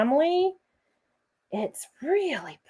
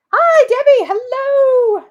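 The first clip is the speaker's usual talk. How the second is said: cheery greeting; 13 semitones higher, 8 dB louder than usual. Real, not acted. Greeting someone off camera.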